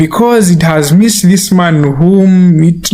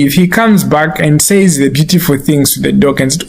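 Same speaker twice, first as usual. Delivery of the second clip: ordinary talk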